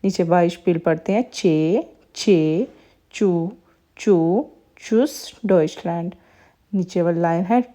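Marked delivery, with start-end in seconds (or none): 1.3-5.4 syllables one by one, चे and चू, building to चूस; "tsch" as ch. Shown as a demonstration. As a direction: drill चे, चू, चूस with one crisp "ch" as in church. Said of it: "चारों word मिलके just चे की साउंड". (चे, चू, चूस में चे की साउंड)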